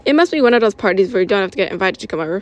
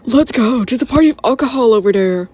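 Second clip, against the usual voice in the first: mild excited yell, a bit raised; half-yelled, pitched up; 3 semitones higher, 4 dB louder than usual. Acted. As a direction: call the gang over to a party. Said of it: "one of the boys yelled".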